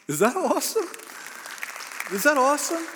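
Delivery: thrilled preacher's rhetorical shout; excited exclaim. Real, not acted. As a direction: lift them up with the question. Preacher rallies congregation after story.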